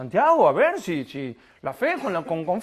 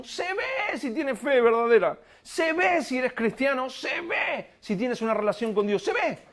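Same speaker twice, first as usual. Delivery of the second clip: higher pitched, insistent proclamation; fervent preaching; 4 semitones higher than usual. Real, not acted. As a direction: hammer home se ve.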